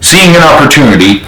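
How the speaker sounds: plain reading voice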